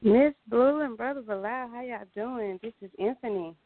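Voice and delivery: bright, lively, high greeting; wide awake and alert. Real, not acted. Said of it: "You sound like you wide awoke like us".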